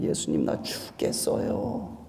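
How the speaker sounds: barely audible whispered plea; weak, breathless whispered prayer; faint whisper. Pretend. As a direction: whisper it as if you have no strength left. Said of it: "기어 들어가는 소리로".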